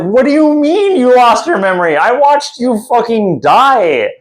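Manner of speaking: high, exasperated mock protest; hammed-up indignant outburst; incredulous Dandelion. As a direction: act out utter disbelief, pitch way up. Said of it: "He's like".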